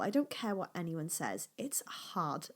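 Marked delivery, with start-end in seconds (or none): 1.6-2.5 much softer whispered It's hard; quiet admission. Real, not acted. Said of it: "small business journey is difficult".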